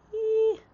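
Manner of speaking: high happy squeal; excited squeal. Real, not acted. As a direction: squeal with delight. Excited squeal over memory.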